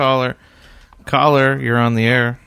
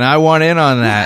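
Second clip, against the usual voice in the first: imitating eager listener.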